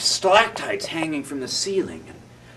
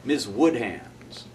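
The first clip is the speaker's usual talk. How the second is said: plain reading voice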